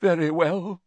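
high, theatrically hurt voice; wounded, high-pitched huff; deeply wounded. A narrator's reading. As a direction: give in with injured dignity. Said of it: "in a deeply wounded voice".

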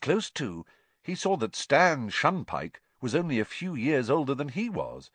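usual speaking voice

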